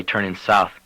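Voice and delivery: ordinary delivery, nothing marked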